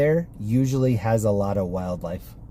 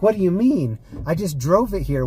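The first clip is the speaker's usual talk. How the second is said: higher, indignant mock outrage; imitating a furious customer; mad; 8 semitones higher than usual. Customer protesting to rental staff, higher mock outrage. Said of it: "And she was so mad".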